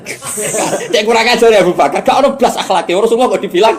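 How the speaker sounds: loud, high mock indignation; inciting intonation. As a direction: rail like a scandalized accuser. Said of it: "tapi intonasinya ndak seperti itu". (meniru penuduh, marah lantang)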